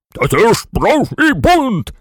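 high, slow, forceful general's cry; outraged exclamation. A narrator's reading. General facing a mutiny among the toys, high outraged shout. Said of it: "вигукнув генерал".

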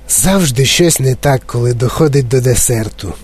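plain reading voice

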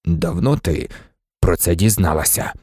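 low, heavy question; low and sober; grave question. A narrator's reading. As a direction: ask gravely, voice dropped. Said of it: "важко глянув на Герміону і запитав".

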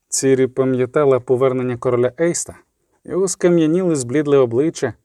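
plain reading voice

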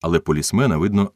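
plain reading voice

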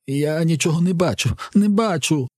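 high-pitched cry of sudden blindness; panicked cry. A narrator's reading. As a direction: cry out high, unable to see. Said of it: "Хлопець кричить". (панічний високий крик)

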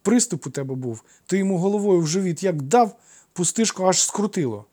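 put-on high, loud boyish account; animated childish voice; excited child. A narrator's reading. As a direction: tell it eagerly like a small boy. Boy excitedly recounts the fight.

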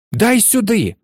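high, urgent demand; mild roar. A narrator's reading. Harry demanding the ball back from Malfoy, mild roar. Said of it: "ревнув Гаррі".

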